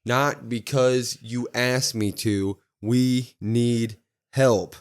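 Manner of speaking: slow deliberate plea, mildly imitated; slow, heavy promo. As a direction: lean on each word, asking for help. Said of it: "He goes".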